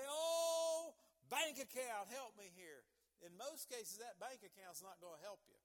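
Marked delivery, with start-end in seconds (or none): 0.0-2.8 loud, high mock plea; mock calling out. Preacher mimicking someone praying to a bank account, hammed-up loud plea. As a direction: cry out with a long, swelling "Oh", then ease off. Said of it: "When they call out, say".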